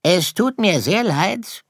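polite predator apologising; mock-sorry fox. Fox prepares to eat Sirius. (scheinheiliger Fuchs, hohe Stimme)

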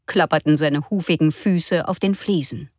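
ordinary delivery

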